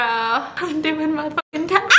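ordinary delivery

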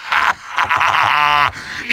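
long theatrical villain cackle; evil laugh. Acted. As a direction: cackle in gloating triumph. Villain gloating at the hero.

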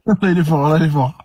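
laughing. Real, not acted. Laughing at a joke.

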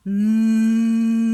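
a loud, sustained nasal "Mm" hum; bear hum. Shown as a demonstration. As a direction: hum "Mm" like a purring bear cub. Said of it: "poczujecie drganie skrzydełek nosa".

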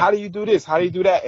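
mock kid voice pestering with questions. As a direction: ask it the way a curious kid would. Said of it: "almost like a kid would".

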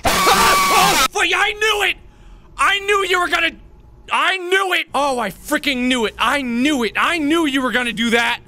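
panicked screaming after a jump scare; scared horror game streamer. Real, not acted. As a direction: yell in real fright.

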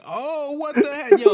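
high, slow, startled shriek; shocked yelp. Retold or imitated. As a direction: squeal it out in shock. Retelling his panicked reaction.

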